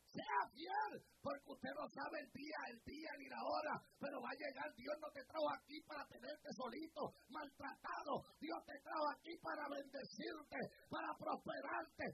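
fervent pulpit exhortation; full-force preaching. Real, not acted.

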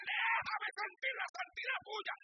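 high, pleading shout to God; crying out in prayer. Retold or imitated. Preacher retelling his prayer on the mountain, shouting. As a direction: cry out, begging to feel his holiness. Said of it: "Y comencé a gritar".

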